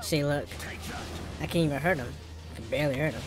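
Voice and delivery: ordinary talk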